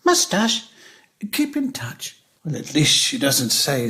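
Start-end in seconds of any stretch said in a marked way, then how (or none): 0.0-2.2 daughter's sign-off. Retold or imitated. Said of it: "Then it's".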